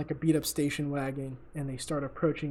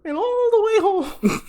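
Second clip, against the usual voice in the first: much higher, slow, hammed-up moaning into the phone; mock moaning rival; 9 dB louder, slower than usual. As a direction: squeal it like a show-off caught mid-act. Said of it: "he starts off the call like".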